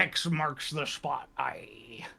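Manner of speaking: slow pirate voice, whispered aye; pirate line ending in a whisper. Acted. Pirate voice continued.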